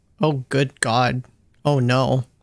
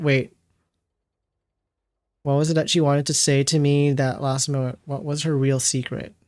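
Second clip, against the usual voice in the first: normal talking voice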